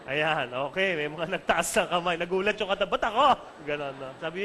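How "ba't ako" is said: loud, higher-pitched protest of surprise; startled seatmate. Acted. Surprised seatmate reacting to being pointed at, startled. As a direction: blurt it like someone suddenly singled out.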